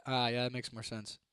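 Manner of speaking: ordinary delivery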